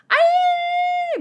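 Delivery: very high, loud shriek; high scream. Acted. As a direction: shriek in panic. Character cries out in manga.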